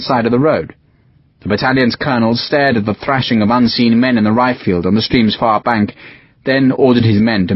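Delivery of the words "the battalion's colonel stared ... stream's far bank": plain reading voice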